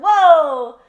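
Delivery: sudden loud high whoa; a shocked gasp of a whoa; startled whoa. Retold or imitated. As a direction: blurt it out, caught off guard. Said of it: "I was like".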